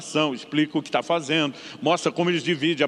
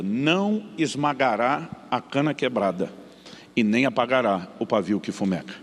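solemn scripture reading; 4 semitones lower than usual. Acted. Reads Isaiah verse solemnly.

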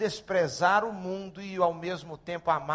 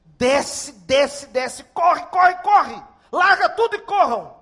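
urgent yelling, rising higher and louder; loud, escalating shouted commands; robbers' shouted orders; 10 semitones higher, 14 dB louder, slower than usual. Retold or imitated. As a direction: bark orders like a robber in a hold-up. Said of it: "começaram a gritar".

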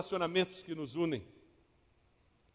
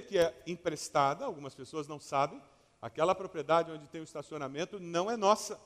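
ordinary talk

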